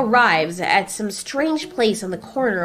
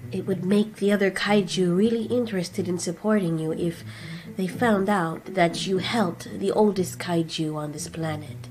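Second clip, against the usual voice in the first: softer, measured voice; gentle Scylla; 5 dB quieter than usual. Acted. Scylla counsels Godzilla, soft and measured. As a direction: speak quietly and carefully as the gentle one.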